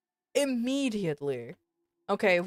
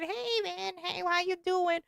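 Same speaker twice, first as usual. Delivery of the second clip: higher, softer mock greeting from the guys; casual bro greeting; 10 semitones higher, 5 dB quieter than usual. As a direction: toss off a lazy hey man. Mimics everyone's greeting in call.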